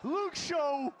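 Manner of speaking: rising from high to shouted call; building hype. Real, not acted. Caster builds to climax.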